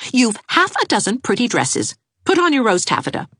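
put-on firm young woman; commanding Anne. A narrator's reading. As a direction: order her out of bed. Anne brisk.

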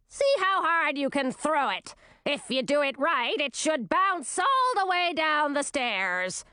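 high, sneering queen voice; scornful old queen. A narrator's reading. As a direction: sneer at your returning daughter.

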